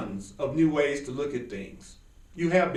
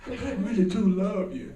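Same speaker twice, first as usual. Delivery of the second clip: mock abuser; 5 semitones higher than usual. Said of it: "I just got through abusing you".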